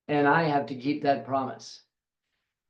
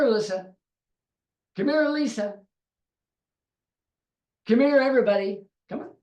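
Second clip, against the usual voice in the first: higher, louder calls rising toward the end; God calling out; 5 semitones higher, 3 dB louder than usual. Acted. God calls his people by name, eagerly and loudly. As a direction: call each name eagerly, more urgent each time.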